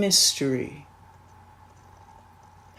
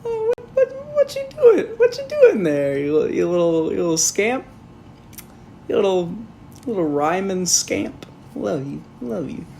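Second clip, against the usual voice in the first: mock Constantine; 3 semitones higher than usual. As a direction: coo at him like a pet. Voicing Constantine to Etrigan.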